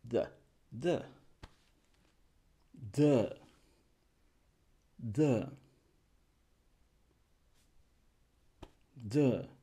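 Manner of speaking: "the" as a slack, weak "thuh"; lazy schwa. Shown as a demonstration. Teacher models saying it with laziness, weak thuh. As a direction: say each "the" as if too lazy to open it. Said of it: "Tienes flojera y dices".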